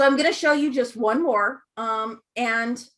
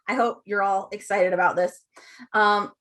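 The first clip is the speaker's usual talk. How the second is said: ordinary talk, nothing marked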